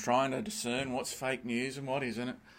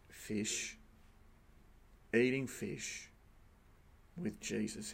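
near-whispered, slow "Fish, eating fish with Jesus"; hushed deadpan; 3 semitones lower, 9 dB quieter, slower than usual. Retold or imitated. Preacher replaying the lecturer's quiet answer, hushed. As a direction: let it drop softly, word by word. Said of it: "Alan Muggeridge's answer was".

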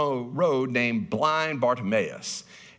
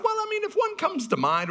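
high mock quote. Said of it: "And I said".

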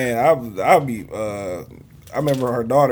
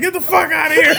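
mock mom; 4 dB louder than usual. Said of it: "She's like".

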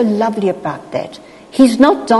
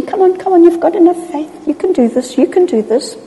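high, coaxing cheer-on; eager, higher-pitched reassurance; acted encouragement; 10 semitones higher, faster than usual. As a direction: urge them on like a little one taking first steps. Acts Jesus coaxing Peter on.

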